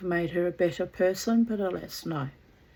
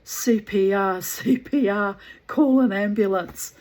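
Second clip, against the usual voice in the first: loud retold emergency call, slightly raised pitch; nurse's urgent call; 4 semitones higher, 10 dB louder than usual. Nurse calling for help over a patient, retold urgent shout. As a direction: call out loudly like a nurse taking charge. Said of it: "she could hear the nurse saying".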